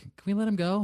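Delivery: put-on high-pitched child's question; lightly voiced pleading kid; son's plea. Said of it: "your son's like".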